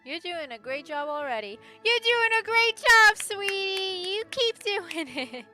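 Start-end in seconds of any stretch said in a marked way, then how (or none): 1.8-5.5 loud, high, drawn-out cheer; hammed-up cheering. Streamer cheers on a game character, loud hammed-up encouragement. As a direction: gush it at full volume, stretching the words.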